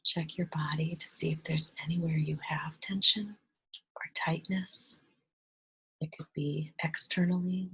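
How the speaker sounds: soft, hushed meditation voice with long pauses; guided meditation. Real, not acted. Guide leading listeners to relax and breathe, hushed meditation voice.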